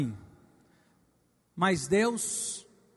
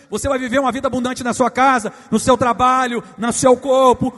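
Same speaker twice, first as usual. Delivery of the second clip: getting louder, fast then punching each phrase; rising preaching; 2 semitones higher, 10 dB louder than usual. Real, not acted. Pastor to congregation, building to a loud proclamation. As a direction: build the promise to a shout.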